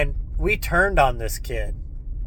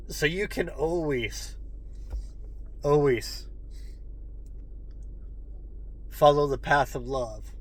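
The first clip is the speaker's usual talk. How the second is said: choked up; 7 dB quieter than usual. Real, not acted. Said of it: "I'm getting so choked up".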